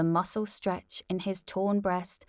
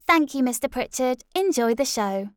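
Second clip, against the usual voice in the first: chirpy host; 6 semitones higher, 7 dB louder than usual. Acted.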